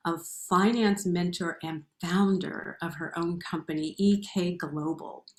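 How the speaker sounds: loud, upbeat show host intro; announcer voice. Acted. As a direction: introduce the guest like a presenter.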